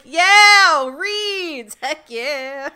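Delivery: loud, high gleeful cheer; excited Korra. Retold or imitated. Korra cheering about the reeds, loud and high. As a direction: cheer like she finally got her wish. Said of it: "she's just like".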